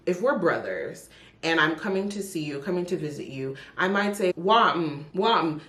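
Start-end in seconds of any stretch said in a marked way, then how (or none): 4.4-5.6 casual Jamaican patois greeting. Shown as a demonstration. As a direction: greet a brother the Jamaican way, wam. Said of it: "That's just me saying what's happening".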